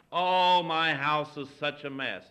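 loud, drawn-out "Oh", then slow lament; mock flustered apology. Host apologizing to a visitor for the mess, mock flustered. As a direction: play up a host's apology for the house.

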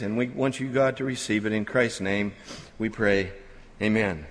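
soft, reverent prayer voice. Real, not acted. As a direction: pray quietly and humbly to God. Opening prayer before sermon.

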